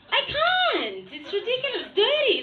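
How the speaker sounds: high-pitched comic exasperation; exasperated complaint. Acted. Mother fed up with the dirty house, exasperated.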